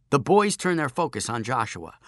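usual speaking voice

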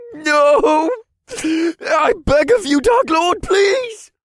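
high, sobbing cry for mercy; crying plea. Acted. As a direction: wail and beg. Captive crying begging.

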